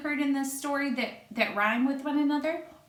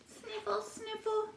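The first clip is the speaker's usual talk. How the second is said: much higher, softer little sniffles; flea sniffling; 11 semitones higher, 7 dB quieter than usual. Acted. Flea with a cold sniffling, high soft sniffles. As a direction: sniffle like a tiny stuffed-up bug. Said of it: "No one heard him sniffle".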